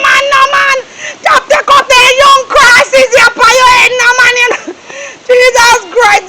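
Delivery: crying shout. Acted. Man despairing over his love trouble, tearful wail. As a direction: wail it out, near breaking down.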